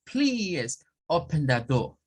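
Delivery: slow British accent, R dropped in door; British accent request. Shown as a demonstration. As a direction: ask it like a Brit. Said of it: "escuchar a un británico".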